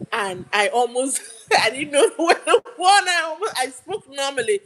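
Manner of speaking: talking through real laughter, pitch rising; laughing. Real, not acted. Storyteller recalling her near slip on the phone, laughing. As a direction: crack up while telling it.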